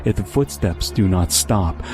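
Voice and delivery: usual speaking voice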